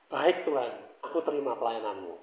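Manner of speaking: soft, low humble answer to the Lord; humble reply. Acted. Preacher models a humble answer to God, softly submissive. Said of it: "harusnya kita berkata apa kalau kita rendah hati".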